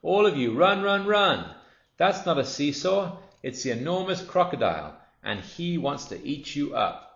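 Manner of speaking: high loud frantic warning, fading slightly at the end; monkey screaming to the children to flee; urgent shouting. Acted. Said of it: "Mugglewump shouted to the children".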